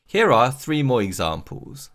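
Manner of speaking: usual speaking voice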